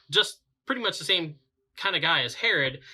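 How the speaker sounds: usual speaking voice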